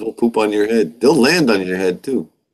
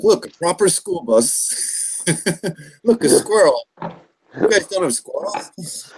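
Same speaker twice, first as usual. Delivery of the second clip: high, slow, wide-eyed excitement; excited English visitor; 8 semitones higher, slower than usual. Retold or imitated. Mother imitates Michael, amazed by American sights. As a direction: gush like a tourist amazed at everything. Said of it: "he was like".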